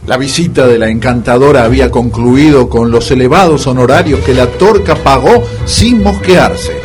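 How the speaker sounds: ordinary talk, nothing marked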